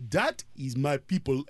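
low Liberian-accented friend impression; imitated Liberian accent. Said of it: "but he went like this".

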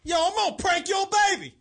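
high, loud hype-man voice; hyped-up bit. Shown as a demonstration. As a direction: pitch it up and punch it. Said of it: "Do it one more time".

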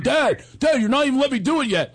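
lightly voiced son protesting to dad; protesting teen. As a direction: imitate an exasperated kid. Son protesting over dad's laughter.

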